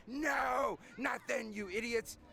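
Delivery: high, shouted comic correction ending in a roar; exasperated old colonel. Acted. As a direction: shout down the idiots, drawing out the last word into a roar. Old colonel snaps back at younger guy.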